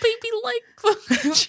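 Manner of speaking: put-on very high, squeaky baby talk; high-pitched toddler voice. Host copying the co-host's take on the ex, high toddler voice. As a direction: mimic a toddler far up in pitch. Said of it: "very high-pitched voice and spoke like a toddler".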